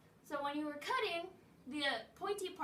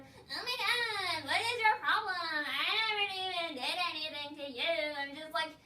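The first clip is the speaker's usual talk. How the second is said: mock a dramatic girl playing victim; hammed-up teen wail fading to a hiss; whiny mock girl; 4 dB louder than usual. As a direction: overblown whiny diva, whimpering then hushed. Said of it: "then she'd be like".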